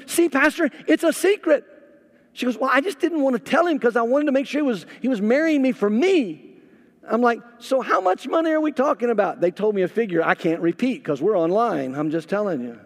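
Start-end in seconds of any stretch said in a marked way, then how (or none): 0.1-1.7 high, loud told-you-so; vindicated groom. Retold or imitated. 2.6-6.6 hurried bride. Retold or imitated. Bride explaining fast. 7.6-9.4 eager pastor. Retold or imitated.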